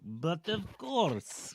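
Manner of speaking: hammy French butler voice; slow, posh French "but of course"; French accent. Retold or imitated. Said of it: "Like Louis or whatever".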